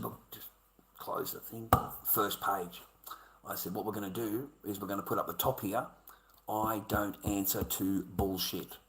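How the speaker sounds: a quiet, deliberate retort to the lawyer; real quiet. Retold or imitated. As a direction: keep it hushed and cold. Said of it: "And I stood real quiet".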